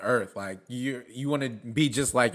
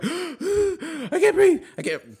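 mock winded panic; 14 semitones higher, much slower than usual. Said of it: "crying, all that shit".

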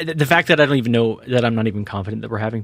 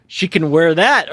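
mock loud call; 6 semitones higher, 5 dB louder than usual. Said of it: "I said something really loud".

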